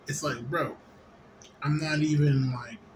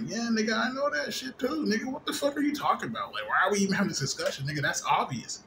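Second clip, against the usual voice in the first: much higher, exasperated disbelief; incredulous outburst; 8 semitones higher than usual. Retold or imitated. Answering his brother in high-pitched exasperated disbelief. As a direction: squeak it out in outrage.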